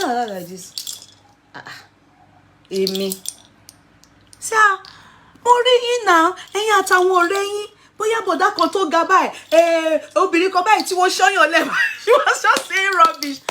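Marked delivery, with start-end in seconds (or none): 1.5-11.7 much higher and louder protest; mock denial. Acting out her own denial. 11.9-13.4 laughing. Real, not acted. Laughing while retelling.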